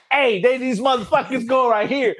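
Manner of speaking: a lightly retold scream of enemy positions; retold yelled callout. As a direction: call the enemy spot to the team, half-yelled. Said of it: "me screaming out".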